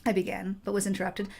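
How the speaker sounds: usual speaking voice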